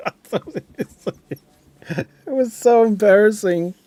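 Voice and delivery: high, breaking into giggles; real helpless laughter in the voice; laughing while talking. Real, not acted. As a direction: laugh through an embarrassed confession. Laughing at own embarrassing memory.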